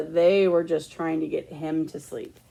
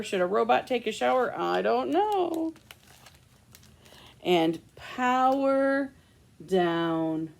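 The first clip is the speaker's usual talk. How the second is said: plain reading voice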